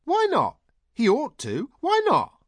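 very high, loud protesting; loud, childlike high insistence; insistent cry. A narrator's reading. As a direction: demand it in a high-pitched shout. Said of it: "Heather cried".

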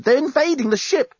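high, alarmed insistence; urgent protest. A narrator's reading. Lucy warning the amorous journalist, protesting. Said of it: "she protested".

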